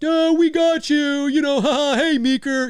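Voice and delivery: mock prank caller. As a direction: tease it like a jokey prank caller. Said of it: "this is not just".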